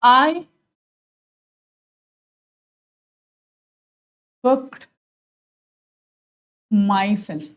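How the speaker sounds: very slow, long gaps between words; spaced-out classroom dictation. Shown as a demonstration. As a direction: dictate it while writing, one word at a time. Writing out the example word by word.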